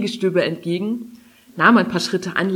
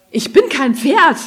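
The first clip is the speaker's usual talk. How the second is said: loud, high-pitched offended outburst; indignant; 7 semitones higher, 8 dB louder than usual. A narrator's reading. Offended dragon rebukes Kari for wanting a ride, indignant. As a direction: protest with wounded pride. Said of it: "Raventos schnaubte".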